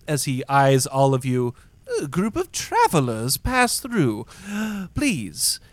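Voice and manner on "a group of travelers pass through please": high, swooping effusive host voice; gracious inn greeter. Acted. Inn host eyeing the travelers, effusively gracious. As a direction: gush politely at the guests. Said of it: "bows to you and says".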